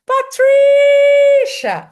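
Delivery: long, high, loud call with stretched stressed syllable; drawn-out, high-pitched calling demo. Shown as a demonstration. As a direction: call it out as if across a distance. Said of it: "But if I say it".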